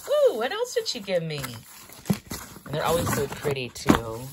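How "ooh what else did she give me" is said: high-pitched, louder, delighted ooh; excited curiosity. Real, not acted. Vlogger opening a package from a company, excited curiosity. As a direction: wonder aloud with eager, high excitement. Eager unboxing of gifts.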